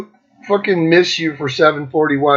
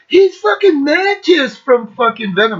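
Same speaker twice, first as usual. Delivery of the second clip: high-pitched starstruck outburst, imitated; freaking out; 10 semitones higher than usual. Excited fan reveals who Jeff really is, freaking out. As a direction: blurt it high like an overexcited fan.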